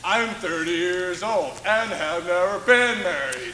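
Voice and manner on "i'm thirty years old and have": deep put-on voice; lower voice. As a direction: drop the voice low for the camera.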